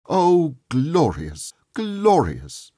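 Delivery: high, slow, rapturous; exultant. A narrator's reading. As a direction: savour the word in rapture. Scrooge exulting at the window.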